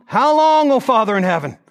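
high, loud plea; anguished cry. Pretend. Preacher voicing a mom whose teen hates her, crying out. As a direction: cry out like a hurt mother.

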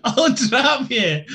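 hammed-up Glasgow hard man, slowly; glasgow street accent. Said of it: "traditional Russian phrase there".

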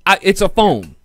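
ordinary talk

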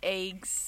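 "eggs" said as "aygs"; imitated long vowel. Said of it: "we've got, like, lazy vowels".

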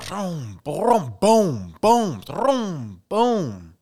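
rapid run of high, booming explosion noises; firework barrage. Retold or imitated. Describing a war-zone fireworks scene, imitated booms. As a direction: imitate shells going off, each boom swooping down.

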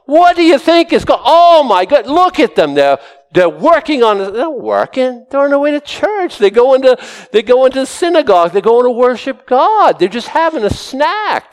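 high, shocked, nagging accusation; scandalized Pharisees. Retold or imitated. Pharisees accusing the disciples of working on the Sabbath, shrill and scandalized. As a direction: clutch your pearls at the disciples. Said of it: "Now the Pharisees, the religious, come along".

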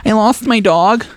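high-pitched mock plea; mock sob story. Con artist to gas station people, mock-pitiful.

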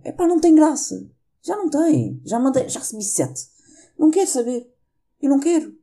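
real annoyance at the voice notes; exasperated. Real, not acted. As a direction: throw up your hands at the joke audios. Fed up with the audios.

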